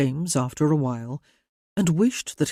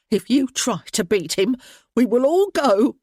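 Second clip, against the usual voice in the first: shaky defiant mother, rising higher; voice shaking; 7 semitones higher, 5 dB louder than usual. A narrator's reading. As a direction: protect her son with a trembling voice. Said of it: "her voice shaking".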